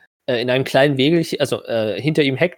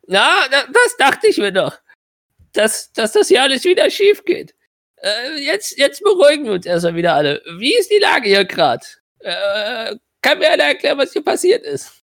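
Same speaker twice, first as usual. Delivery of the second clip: put-on high, exasperated old wizard; 12 semitones higher than usual. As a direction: take charge, high and tired. Clock voiced by GM.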